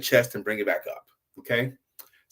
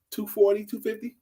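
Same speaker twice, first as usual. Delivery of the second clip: surprised question; 8 semitones higher than usual. Retold or imitated. Scientists questioning his claimed weight.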